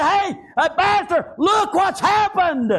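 high, slow screaming call; screamed alarm. Acted. Servant screams to his master about the army. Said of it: "He screamed out".

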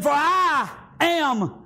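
drawn out, shouted promise; slow shouted proclamation. Acted. Preacher proclaiming God's promise, slow shout. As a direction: stretch every word like a proclamation.

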